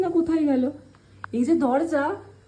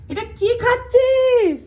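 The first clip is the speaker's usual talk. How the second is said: shocked; 8 semitones higher, 6 dB louder, slower than usual. Real, not acted. Owner catches cat chewing cable.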